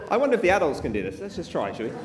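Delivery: ordinary delivery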